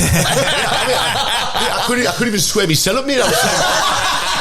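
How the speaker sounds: words broken up by high laughter; laughing it out; laughing while talking. Real, not acted. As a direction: talk through the giggles. Laughing about Conor McGregor.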